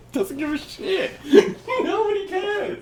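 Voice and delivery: high, breathless laughing. Real, not acted. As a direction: laugh it out, barely holding it. Laughing at dismissive host.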